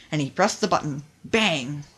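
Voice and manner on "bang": ordinary delivery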